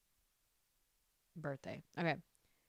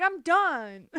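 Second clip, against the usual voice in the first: mock declaration; 9 semitones higher, 7 dB louder than usual. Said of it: "I was like".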